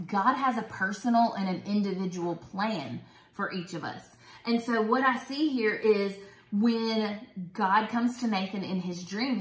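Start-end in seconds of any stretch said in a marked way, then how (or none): none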